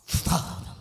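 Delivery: breathy unvoiced Father; whispered Father. Preacher drops to a whisper on Father. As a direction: breathe the word Father softly.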